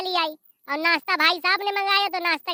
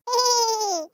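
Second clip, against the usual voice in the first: put-on child's giggle; 6 semitones higher than usual. Child character laughs mischievously.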